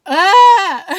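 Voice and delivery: high, loud frustrated "ah"; exasperated shriek. Real, not acted. Venting about missing the ferry, a frustrated yelp. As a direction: burst out in pure frustration. Exasperated outburst mid story.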